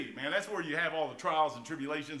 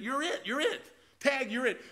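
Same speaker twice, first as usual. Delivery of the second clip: playful tag call; 7 semitones higher than usual. Real, not acted. Playful high emphasis to congregation.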